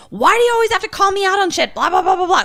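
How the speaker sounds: high, rushed mock whine; exasperated comeback. Retelling a spat with a partner, exasperated. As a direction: rattle off a defensive protest, high-pitched.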